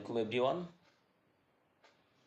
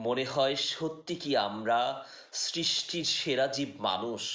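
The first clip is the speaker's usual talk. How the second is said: raised, projected Bengali poetry recitation; poem recitation; 4 semitones higher, 7 dB louder than usual. Acted. Reciting a poem that questions humankind, declamatory poetry voice. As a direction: recite in measured phrases, higher and louder than chat.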